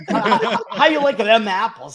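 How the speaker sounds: put-on Boston accent. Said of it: "not really a Boston thing to say".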